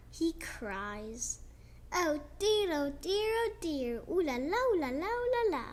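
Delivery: ordinary delivery, nothing marked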